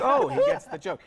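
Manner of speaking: sudden delighted oh; mock surprised realization. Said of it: "I was like".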